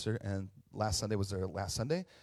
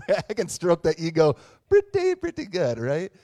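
real laugh breaking through, louder; 6 semitones higher, 10 dB louder than usual. Real, not acted. Pastor joking about stroking own ego, laughing loudly. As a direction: laugh at your own bragging.

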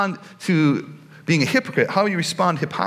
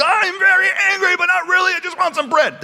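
very high-pitched comic tantrum, louder at the start; hammed-up squealing outburst over bread; mock angry flip-out; 4 dB louder than usual. Preacher acts out Jesus cracking on a diet, comically flipping out. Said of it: "he just flips out on them".

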